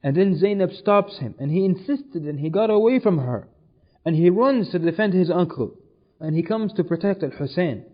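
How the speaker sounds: ordinary talk, nothing marked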